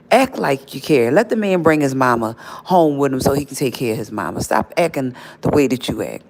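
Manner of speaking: ordinary talk, nothing marked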